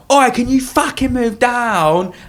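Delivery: high, slow demand; mock rude commuter. Rude commuter on a train telling people to move, high. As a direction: mimic a pushy commuter. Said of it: "People are like".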